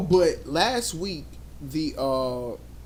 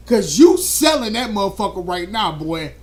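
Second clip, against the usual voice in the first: usual speaking voice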